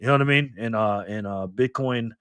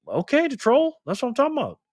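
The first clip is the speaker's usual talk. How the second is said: excited approval; 11 semitones higher than usual. Real, not acted. Delighted by the troll's comment.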